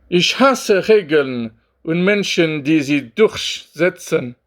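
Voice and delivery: slow, careful German; speaking German. Acted. Switching to German on Bibo's channel, slow careful German. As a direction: sound out the German deliberately, word by word. Un peu d'allemand maintenant.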